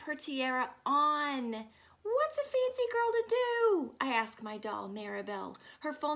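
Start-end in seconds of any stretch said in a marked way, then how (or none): 2.0-3.9 high, louder dramatic sigh of a question; dramatic fancy girl. Acted. Nancy asking herself aloud, high and dramatic. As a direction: play it like a little diva.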